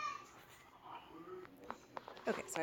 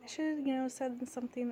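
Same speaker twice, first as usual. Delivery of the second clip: higher-pitched mock apology; apologetic coworker, imitated; 7 semitones higher than usual. Coworker apologizing for staying silent, lightly mocking imitation. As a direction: lightly mimic a sheepish coworker.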